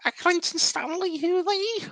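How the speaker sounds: high Scouse voice from an eighties advert; Scouse accent. Retold or imitated. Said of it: "I'm gonna do a Scouse accent now".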